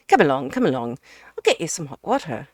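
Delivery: loud, hearty, fast host; booming friend ordering everyone about; bustling Ukridge. Acted. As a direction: hustle him along at full volume. Ukridge bustles the guest upstairs.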